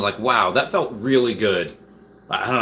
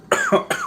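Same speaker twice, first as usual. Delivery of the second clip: sharp high-pitched choking cough. Real, not acted. Choking fit.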